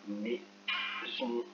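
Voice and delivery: maison split slowly into mai... son; slow word repetition. Shown as a demonstration. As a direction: repeat maison softly, syllable by syllable. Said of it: "Je répète le mot".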